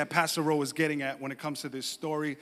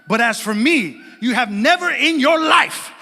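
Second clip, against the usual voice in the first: loud mock-furious telling-off, emphatic; imitating an outraged wife laying down the law; indignant wife; 6 semitones higher, 10 dB louder than usual. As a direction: build to a shouted accusation as the wronged wife. Wife's angry retold denial.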